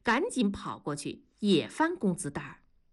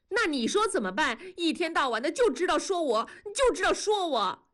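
higher, plaintive, rising wife; close to tears; 9 semitones higher than usual. A narrator's reading. Wife nagged all day, mildly tearful and on the verge of crying. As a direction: whimper the complaint, about to cry. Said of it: "马上要哭的架势".

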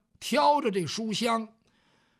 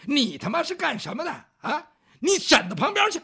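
rough brawler; 5 semitones higher, 5 dB louder than usual. Acted. Ruffian rebuffs the scholar.

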